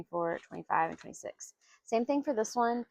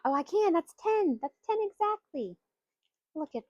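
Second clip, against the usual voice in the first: surprised; 10 semitones higher, 4 dB louder than usual. Real, not acted. Surprised the roll totals ten.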